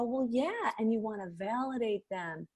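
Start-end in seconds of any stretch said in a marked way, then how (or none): 0.0-0.7 softer, calm validating tone; slowed-down voice. Shown as a demonstration. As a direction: bring the energy right down. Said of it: "you wanna slow down your voice and go".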